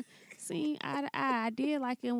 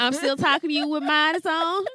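high voiced clerk, louder; man doing a woman's voice; 4 semitones higher, 7 dB louder than usual.

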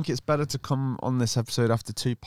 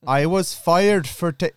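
put-on aborted try at an Indian accent, slower and higher; half-hearted Indian accent; attempted Indian accent; 8 semitones higher, 5 dB louder, slower than usual. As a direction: start the quote in an Indian accent, then give up. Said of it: "no, I can't do the accent".

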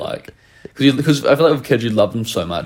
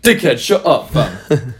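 put-on British accent; slower than usual. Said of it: "doing a British accent".

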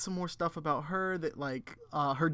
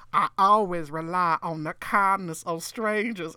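louder hammed-up Southern belle; mock Southern accent; 2 semitones higher, 5 dB louder than usual. Coworker repeating Carol's line back to her, mock Southern accent. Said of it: "Carol can do a southern accent".

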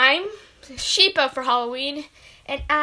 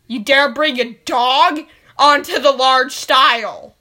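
loud outraged demand; yelling; 10 dB louder than usual. Acted. A parent yelling at the child over the dog, furious. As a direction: roar it at the child. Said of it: "Stop yelling at your father".

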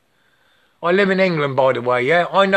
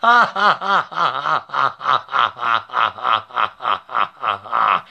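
low, rhythmic staccato laugh; drawn-out rattling laugh; long cackle; 6 semitones lower than usual. Real, not acted. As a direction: let out a long run of ha-ha-ha laughter. Drawn-out laughing fit mid-rant.

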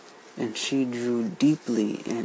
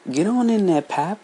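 put-on high, loud, sharp snap at the boy; snapped order; 9 semitones higher, 6 dB louder than usual. A narrator's reading. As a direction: snap him into the room. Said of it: "Miss Emma Julia snapped".

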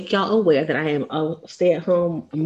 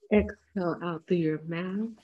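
guided breathing. Acted. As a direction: ease listeners through each breath.

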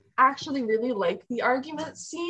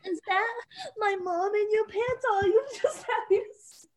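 high, put-on anime girl question; mock-innocent anime girl; 9 semitones higher, 3 dB quieter than usual. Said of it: "I'm gonna do that for Raven's voice".

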